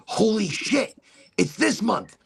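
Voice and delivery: high-pitched, astonished outburst; shocked realisation. Real, not acted. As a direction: let the shock jump up in pitch. Repeats it as realisation sinks in.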